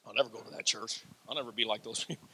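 soft, low mock of a stubborn refuser; mocking quote. Preacher mimics people who refuse his church, in a soft low mocking voice. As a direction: mutter it like someone who swears they'll never come. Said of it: "people that said".

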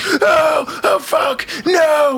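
reliving the panic, half crying and half shouting; terrified screaming. Retold or imitated. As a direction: shriek it out like a snake is right in front of you. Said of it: "I am screaming the entire time".

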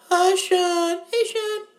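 high, far-off voices of an AA group greeting Sean; support group chorus. Pretend. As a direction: chime in like a whole support circle.

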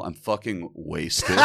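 put-on slow, low drunk admission; punchline confession, stretched and laughing; drunken confession. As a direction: draw it out as a comic confession.

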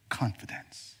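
whispered. Acted. Hebrews writer pleading with fellow Christians, whispered. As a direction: breathe it like a secret.

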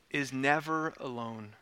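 ordinary delivery

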